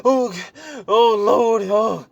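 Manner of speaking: high, loud, frantic mock wailing; hammed-up terror crying to the Lord; panicked disciples. Frightened disciples crying out to the Lord, hammed-up wails.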